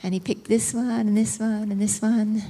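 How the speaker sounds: ordinary talk, nothing marked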